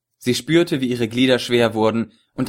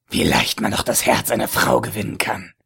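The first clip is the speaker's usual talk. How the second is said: lecherous villain voice. A narrator's reading. Ägypter, höhnisch. (tiefe, lüsterne Schurkenstimme)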